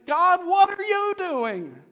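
high, loud, slow anguished plea; desperate cry to heaven. Retold or imitated. David looking up to heaven, crying out in anguish. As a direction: stretch it out, begging heaven for an answer.

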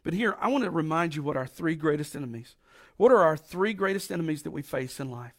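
usual speaking voice

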